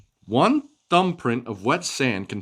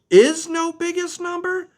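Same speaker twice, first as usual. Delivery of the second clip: amazed question; 4 dB louder than usual. Acted. Pebbles amazed by infinity.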